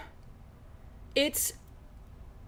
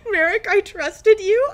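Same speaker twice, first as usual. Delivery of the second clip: betrayed and heartbroken; 8 semitones higher, 8 dB louder than usual. Pretend. Mock betrayal at a friend.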